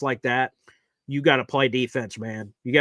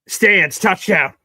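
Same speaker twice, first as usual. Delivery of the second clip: excited; 4 semitones higher, 7 dB louder, much slower than usual. Real, not acted. Ref confirms touchdown call.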